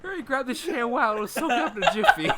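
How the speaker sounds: high, loud laughing orders; hammed-up high-pitched hurry; mock urgency. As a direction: shout orders in comic panic. Playing along as panicked pirate.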